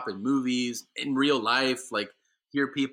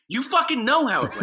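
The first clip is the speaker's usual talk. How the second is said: high, loud outburst with some laughter; retold indignant comeback; 12 semitones higher, 7 dB louder than usual. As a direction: throw the question back, mock-outraged.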